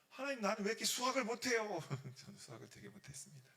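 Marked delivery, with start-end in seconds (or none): 0.1-1.8 mock complaint. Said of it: "화가 나거나 내 마음속에".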